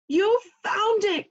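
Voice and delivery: high, slow thrilled exclamation; Toad's joyful discovery cry; excited cry. Acted. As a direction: burst out with delight as Toad finds spring. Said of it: "cried Toad".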